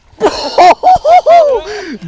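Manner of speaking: high burst of laughter; excited laugh. Real, not acted. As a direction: laugh out in disbelief. After landing big fish.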